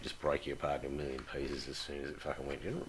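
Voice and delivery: ordinary talk, nothing marked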